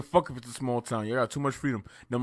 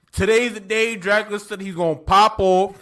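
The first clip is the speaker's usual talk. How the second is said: mocking townsfolk; 4 semitones higher, 6 dB louder, slower than usual. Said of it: "He's like".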